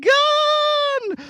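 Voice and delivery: high, loud, slow cry of alarm; startled high shriek; dragon shock. Acted. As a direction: shout it as the dragon appears. Dragon appears again.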